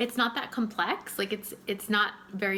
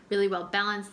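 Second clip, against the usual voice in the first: ordinary delivery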